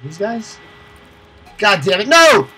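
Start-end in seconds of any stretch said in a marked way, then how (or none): 1.6-2.5 frantic, loud and high; panicking gamer. Real, not acted. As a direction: shout in sudden panic. Spotted by enemies, panic.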